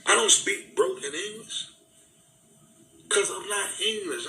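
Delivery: high-pitched, impassioned protest; indignant outburst. Real, not acted. Speaker defending their own accent to listeners, indignant and high-pitched. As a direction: protest it heatedly, pitch pushed well up.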